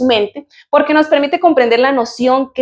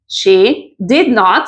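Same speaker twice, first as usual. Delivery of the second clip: slow separated form; much slower than usual. Shown as a demonstration. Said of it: "si quiero decirlo en forma separada".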